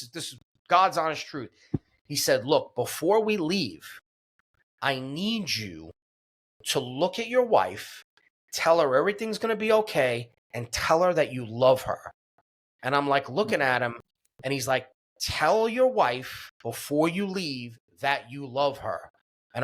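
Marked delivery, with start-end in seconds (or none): 2.5-12.1 grave, deliberate instruction. Retold or imitated. Paramedic's grave instruction before leaving. 15.2-19.1 slow, weighted retold urging; insistent, deliberate instruction. Paramedic urging patient before leaving, slow and insistent.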